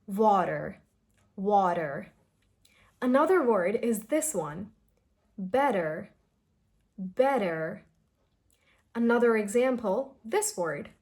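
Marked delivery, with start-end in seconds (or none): 0.1-2.1 "water" with T as a very soft D; "water" slowly, lightly flapped T. Shown as a demonstration. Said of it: "Keep your duh very soft". 5.4-7.8 "better" with T as a soft D; "better" drawn out with a flapped T; American flap T. Shown as a demonstration. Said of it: "a very soft d sound".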